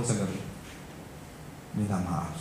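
very soft, low apology; retold humble apology. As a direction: say sorry quietly, voice dropped. Retelling child's apology to father.

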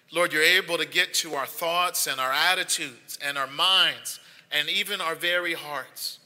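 low, soft, earnest prayer voice. Acted. Pastor praying over the online congregation, soft earnest prayer. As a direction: pray quietly over the people, unhurried.

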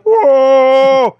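sudden loud high whoa; startled cry. Retold or imitated. Man watching a dinosaur left behind, shocked yell. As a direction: yelp like you've just seen it happen. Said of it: "he's like".

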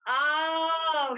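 loud high "Oh" of sudden understanding; sudden realisation. Retold or imitated. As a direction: let it dawn on you all at once. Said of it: "he's like".

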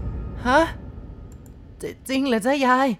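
put-on boy stunned by news of a death; shocked disbelief. A narrator's reading. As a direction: stumble over the words in sudden shock. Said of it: "ผมเองตกใจ".

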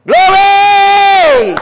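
long, high shout of praise; ringing, drawn-out cry. Real, not acted. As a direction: belt it out at full voice, held long. Preacher cries out in praise.

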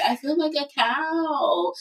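high, slow, drawn-out mock complaint; mock lament. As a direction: stretch it out like a joking grumble. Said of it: "I was like".

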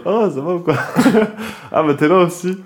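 imitating a drunk greeting, laughing; mock drunk with a giggle. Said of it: "Complètement bourré".

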